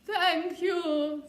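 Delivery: a hint of a grateful unicorn. Unicorn thanking the knight, lightly voiced. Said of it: "said the unicorn".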